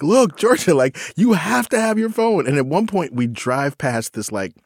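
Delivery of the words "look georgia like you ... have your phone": much higher, pleading father; dad pleading with his daughter, voice pitched up; exasperated dad. Retold or imitated. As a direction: plead hard with the teen. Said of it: "And I'm just like".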